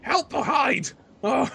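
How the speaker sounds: high-pitched, panicky character mutter; put-on high squawk, talking to himself. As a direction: fret aloud in a high character voice. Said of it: "Oz will mutter to himself".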